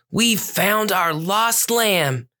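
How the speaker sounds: high, very slow cat character. Acted. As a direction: draw it out like a proud cat. Voicing cat Fuzz.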